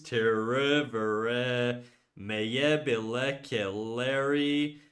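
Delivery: very slow, drawn-out syllable by syllable; sounding out. Real, not acted. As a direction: labour through an unfamiliar long Turkish word. Reading longest Turkish word aloud.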